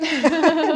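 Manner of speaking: loud, high laugh; real amused laugh out loud. Real, not acted. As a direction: burst out laughing. Joking about losing the audience.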